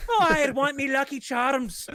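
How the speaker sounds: high, slow stage-Irish leprechaun; hammed-up Irish brogue; mock Irish accent. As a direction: play a cartoon Irish leprechaun. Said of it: "I'm gonna do an accent for you".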